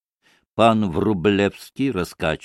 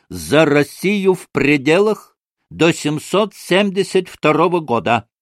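raised, mildly booming proclamation; stentorian toast; 6 semitones higher, 4 dB louder than usual. A narrator's reading. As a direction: pronounce the toast in a ringing voice. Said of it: "зычным голосом проговорил". (зычным голосом, тост)

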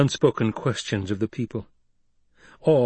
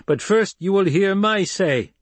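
commanding Voice; 9 semitones higher, 4 dB louder than usual. Acted. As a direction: command them with the Voice. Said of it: "the powers of voice she had taught him".

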